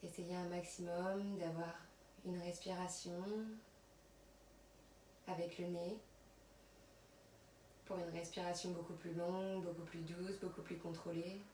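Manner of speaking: soft, unhurried yoga nidra voice with long pauses; guided relaxation. Acted. Yoga teacher settling students on their mats, soft guided relaxation. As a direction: guide them quietly through body and breath.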